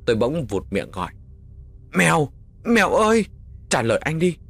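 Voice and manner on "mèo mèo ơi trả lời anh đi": high, loud hopeless cry; desperate screaming call. A narrator's reading. Boy calling the unresponsive girl, high desperate shout. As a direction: scream the name, desperate for an answer. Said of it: "Tôi gọi như thét trong vô vọng".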